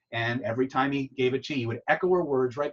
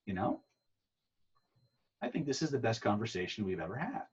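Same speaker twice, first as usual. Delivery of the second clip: softer, a touch of tender sincerity; sincere girlfriend; 7 dB quieter than usual. Retold or imitated. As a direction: say it softly like she truly means it. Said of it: "she said".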